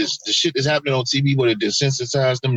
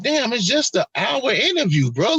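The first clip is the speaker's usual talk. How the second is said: mock exasperated; 8 semitones higher, slower than usual. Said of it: "And you like".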